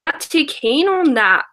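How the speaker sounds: slow Australian "keen", said like "kayn"; Australian accent, slow. Retold or imitated. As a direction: savour the Australian "keen" slowly. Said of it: "obsessed with the word keen".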